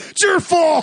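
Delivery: high, loud playful blame; mock accusing shout. Joking with co-host about pointing fingers, mock accusing shout.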